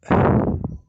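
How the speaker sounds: loud unvoiced sigh, breaking off; heavy sigh. Real, not acted. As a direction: let out a heavy, loud sigh as words fail. Emotional rant breaks off.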